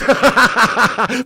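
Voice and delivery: big laugh, rising at the end; a loud scoffing laugh; loud incredulous laugh. Real, not acted. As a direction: burst out laughing at the absurd comment. After scoffing at comment.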